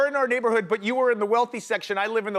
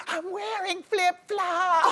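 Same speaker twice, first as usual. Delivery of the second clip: whining daughter; 10 semitones higher, much slower than usual. Retold or imitated. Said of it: "And she was like".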